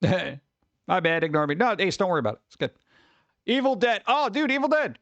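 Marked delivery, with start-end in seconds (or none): 3.5-5.0 excited. Real, not acted. Streamer excited over Evil Dead news.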